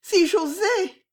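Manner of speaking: very high, slow, timid voice; terrified hesitation. Acted. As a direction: barely dare to speak. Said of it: "ton de terreur".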